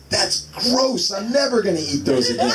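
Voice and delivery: high, disgusted little boy, lightly voiced; eight-year-old son. Son telling dad the boogers are gross, a high grossed-out kid voice. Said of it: "So my son goes".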